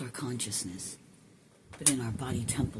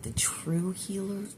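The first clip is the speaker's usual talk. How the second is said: ordinary talk, nothing marked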